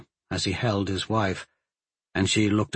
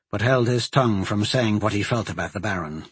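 ordinary delivery

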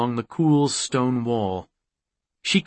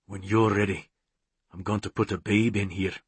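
soft, lower-pitched intimate murmur; tender lover; 3 semitones lower, 5 dB quieter than usual. Acted. McCreeve kneeling before his mate, tender and soft. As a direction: say it low and close, tenderly.

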